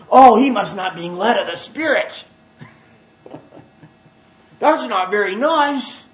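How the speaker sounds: loud mock-shocked churchgoer; mock disapproval. Hearers objecting to harsh talk, mock disapproving. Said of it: "we hear somebody talk like that and we say".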